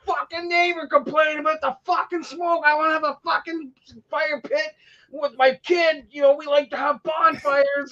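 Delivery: high-pitched mock complaint; imitated yelling. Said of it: "yelling at the neighbor".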